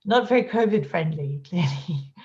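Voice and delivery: ordinary talk, nothing marked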